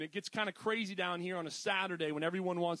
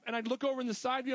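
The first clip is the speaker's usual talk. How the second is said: ordinary delivery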